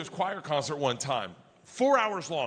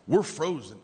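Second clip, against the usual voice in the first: usual speaking voice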